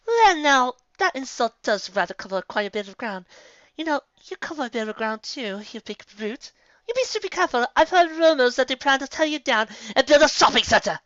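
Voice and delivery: high, prim and superior lady; high, prim put-down; arch lady. A narrator's reading. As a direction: deliver it with a mock-polite smile. Rarity's arch comeback.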